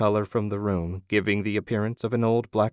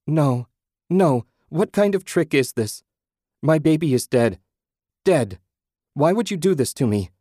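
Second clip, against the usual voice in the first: anguished outburst; 6 semitones higher, 6 dB louder than usual. A narrator's reading. Mother told dead baby lives.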